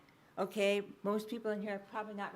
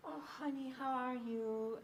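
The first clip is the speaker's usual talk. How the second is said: put-on high-pitched baby talk; 3 semitones higher, slower than usual. Shown as a demonstration. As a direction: coo it sweetly as if to an infant. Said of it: "high-pitched, varied".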